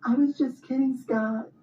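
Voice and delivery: very high, panicky squeak; nervous backpedal. Retold or imitated. Wife panicking to her husband, very high and nervous. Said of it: "She's all like".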